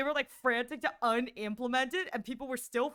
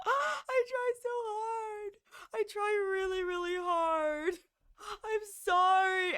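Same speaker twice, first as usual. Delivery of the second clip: mock wailing; 6 semitones higher, slower than usual. Real, not acted. Broke streak, mock wailing.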